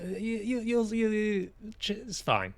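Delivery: usual speaking voice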